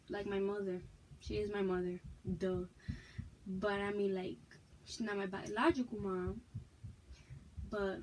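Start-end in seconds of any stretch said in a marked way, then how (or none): none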